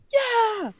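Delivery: squealing high "Yeah!"; a high, delighted cheer; excited squeal. Retold or imitated. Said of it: "she's like".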